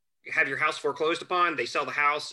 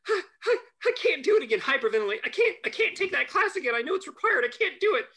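high, loud crying panic; sobbing plea; 13 semitones higher, 3 dB louder, faster than usual. Retold or imitated. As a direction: beg through tears, near hyperventilating. Classmate collapses in tears.

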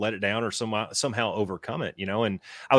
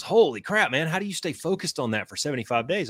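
amazed exclamation; 7 semitones higher than usual. Retold or imitated. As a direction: blurt it out, stunned by the feat. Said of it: "And he goes".

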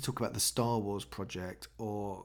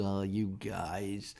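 slow, mild mock tut-tut; a kindly park keeper gently scolding; gentle park keeper; much slower than usual. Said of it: "just taking down a Post-it note going".

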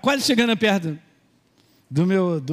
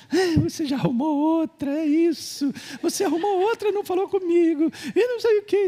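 high-pitched mock jealous girlfriend; 9 semitones higher than usual. Preacher mimics his girlfriend accusing him, high mock jealousy.

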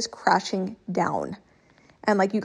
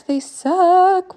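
pitched-up groan of disgust; they suck; 9 semitones higher, 6 dB louder than usual. Real, not acted. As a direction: whine it out. Venting about rejections.

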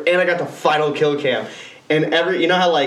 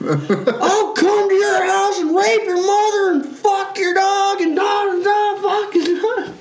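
high, slow, laughing troll rant; mock chat troll; slower than usual. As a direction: ham up a raging online kid, slowly. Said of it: "do you remember those chat rooms".

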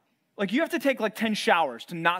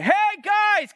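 high, loud shout into the furnace; king calling out; 14 semitones higher, 7 dB louder, much slower than usual. Acted. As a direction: yell it to the men inside the fire. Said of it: "walk up to the door of the furnace and say".

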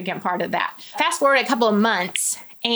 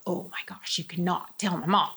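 whispered, pleading; secretive whisper; 4 semitones lower, 8 dB quieter than usual. Retold or imitated. Pleading with the church lady, whispered. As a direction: beg her to keep the secret, hushed.

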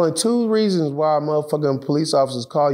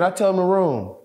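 ordinary talk, nothing marked

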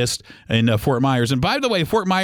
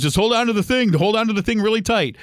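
higher-pitched, lightly mimicked instructor; guy's instruction; 6 semitones higher than usual. Guy instructing a nervous parasailer, mildly insistent. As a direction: imitate someone giving insistent instructions.

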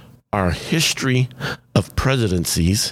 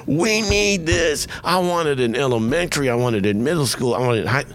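going bananas; 5 semitones higher than usual. Retold or imitated. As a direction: imitate a caller gushing with enthusiasm. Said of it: "she went bananas".

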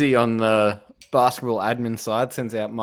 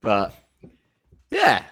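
ordinary talk, nothing marked

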